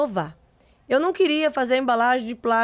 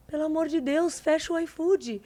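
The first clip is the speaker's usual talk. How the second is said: pleading; 5 semitones higher than usual. Retold or imitated. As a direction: beg him to shut it down, half joking. Begging father to close app.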